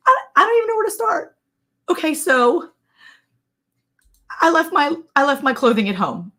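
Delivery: high, distraught retelling; mock emotional. Mother-in-law confessing she left her clothing, mock emotional. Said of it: "she's, like, really emotional".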